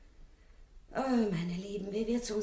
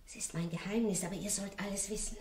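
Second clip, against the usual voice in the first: ordinary delivery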